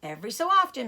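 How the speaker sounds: ordinary delivery, nothing marked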